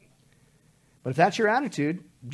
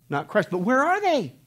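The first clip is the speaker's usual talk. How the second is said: ordinary delivery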